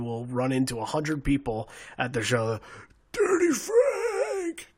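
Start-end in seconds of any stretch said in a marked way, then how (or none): 3.1-4.7 long, drawn-out high mock yell; imitated fan call. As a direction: stretch it out high like a heckler, without volume. Imitating fans calling out at shows.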